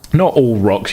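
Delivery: usual speaking voice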